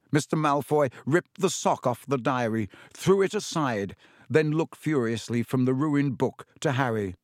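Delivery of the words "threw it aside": plain reading voice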